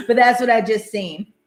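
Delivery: ordinary talk, nothing marked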